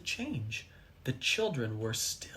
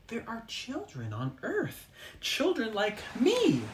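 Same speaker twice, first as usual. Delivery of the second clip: excited cry; 7 semitones higher than usual. A narrator's reading. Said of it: "he cried".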